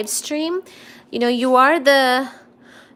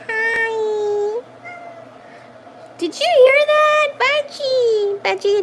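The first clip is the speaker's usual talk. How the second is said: very high squeal to the cat; high delighted squeal at her cat; cooing at cat; 12 semitones higher, slower than usual. Real, not acted.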